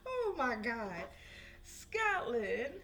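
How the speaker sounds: high, laughing exclamation; amused disbelief. Real, not acted. Reading for Scotland, amused high disbelief. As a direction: exclaim while coming out of laughter.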